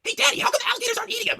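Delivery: put-on very high little kid voice; child asking daddy about the alligators. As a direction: ask like a small child.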